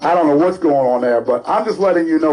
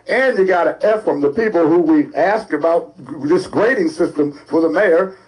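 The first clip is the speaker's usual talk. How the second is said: ordinary talk, nothing marked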